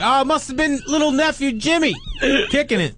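high, sing-song mock innocence; hammed-up fake innocence; fake innocent. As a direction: pretend you saw nothing. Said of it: "I'm like".

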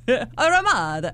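comic put-on Italian accent; mock Italian accent. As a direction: draw it out in fake Italian. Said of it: "Anything's Italian if you say it right".

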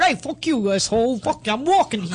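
mock tough New York accent; hammed-up New Yorker yelling in New York accent. She shows how she talks in New York, New York accent. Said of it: "I'm in New York".

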